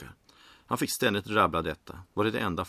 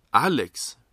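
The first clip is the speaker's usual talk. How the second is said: loud, higher, incredulous query; taken aback; 6 semitones higher, 8 dB louder than usual. A narrator's reading. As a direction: blurt the name in disbelief. Character hears who replaces him.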